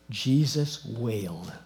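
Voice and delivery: very slow and weighty; grave, drawn-out verse reading; solemn reading. A narrator's reading. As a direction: let each word hang.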